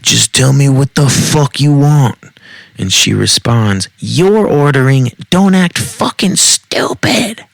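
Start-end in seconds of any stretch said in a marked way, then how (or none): 0.0-2.2 raised, louder exasperated shout; mad yelling. A narrator's reading. Man fed up with the woman ordering, angry yelling. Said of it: "He gets mad and yells at her". 4.0-7.4 high-pitched scornful yell, climbing higher; angry retort. A narrator's reading. Woman yelling back at the man over ordering, angry retort.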